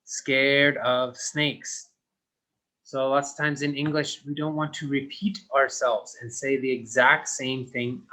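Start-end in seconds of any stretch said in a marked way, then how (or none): 0.0-1.9 slow. Shown as a demonstration. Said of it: "this sentence has the exact same words".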